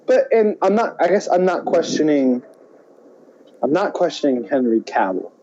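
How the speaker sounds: normal talking voice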